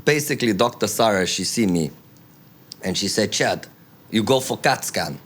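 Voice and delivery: broken-English Hungarian accent; Budapest accent. Shown as a demonstration. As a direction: narrate in a put-on Budapest accent. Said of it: "tell the rest of the story in this accent".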